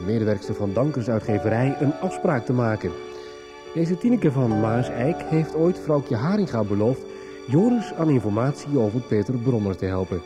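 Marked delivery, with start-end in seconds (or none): none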